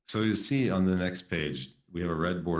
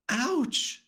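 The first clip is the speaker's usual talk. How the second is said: high sharp yelp of pain. Acted. Fuzzy yelps as his tooth wobbles, high. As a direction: yelp like a hurt monster. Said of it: "Fuzzy yelped".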